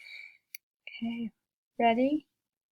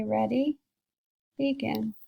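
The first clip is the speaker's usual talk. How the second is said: ordinary delivery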